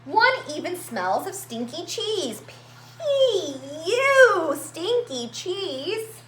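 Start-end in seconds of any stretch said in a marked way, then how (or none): none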